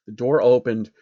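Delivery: usual speaking voice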